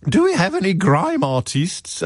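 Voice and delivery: high-pitched mock posh English shout, no real volume; posh colleague calling out. Cameron's colleague at a gathering of the youth, mock posh call. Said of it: "David Cameron's posh colleagues shouting out".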